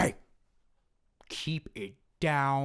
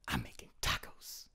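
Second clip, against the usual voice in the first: put-on whispered Mexican accent; 14 semitones lower, 7 dB quieter than usual. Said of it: "Mexican Shrek".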